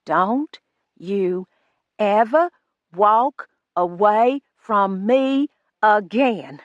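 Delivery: Don't you ever walk away from me again, spaced and slow, each word hit; each word emphasized. A narrator's reading. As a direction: hammer every word with pauses. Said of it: "spacing them, emphasizing each one".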